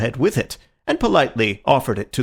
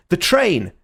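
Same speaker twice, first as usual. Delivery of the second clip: urgent cry; 7 semitones higher, 4 dB louder than usual. A narrator's reading. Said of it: "he cried".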